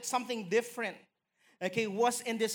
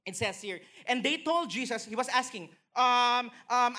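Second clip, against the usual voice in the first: ordinary talk, nothing marked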